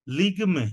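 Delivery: লিগমে said lig-me with no middle vowel; two quick syllables: লিগমে; ligme, not ligami. Shown as a demonstration. Teacher repeating the model word, two-syllable লিগমে. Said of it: "এটা কিন্তু লিগামি না".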